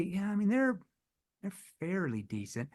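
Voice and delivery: dropping soft and hesitant on fairly decent; seed doubt softly; concerned tone. Shown as a demonstration. Salesman softly doubting the prospect's firm, concerned. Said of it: "I used the concerned tone".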